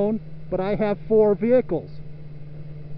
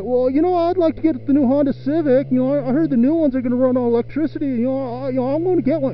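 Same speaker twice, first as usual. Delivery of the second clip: mock suck-up voice; 6 semitones higher, faster than usual. Mocking the Honda guy sucking up.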